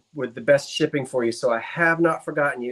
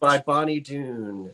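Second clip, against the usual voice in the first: slow, drawn-out verse; slowed cadence; 2 semitones lower, slower than usual. Pretend. Reciting slower while partner interjects, slowed poem recitation. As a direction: stretch each word of the poem. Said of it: "Slow your cadence".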